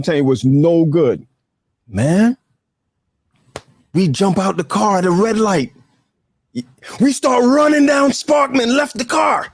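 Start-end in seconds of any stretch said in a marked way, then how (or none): 4.1-9.5 high-pitched, worked-up storytelling; excited retelling. Retold or imitated. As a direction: shout it out like fleeing in a panic. Tells panicked flight from car.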